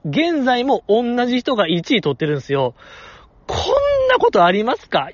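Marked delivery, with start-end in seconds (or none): 3.5-5.1 incredulous. Real, not acted. Ranting about a cheating top scorer.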